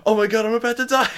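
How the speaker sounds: high, fast, loud panicking; hammed-up fear of imminent death; mock panic. Doomed man before he explodes, mock panic.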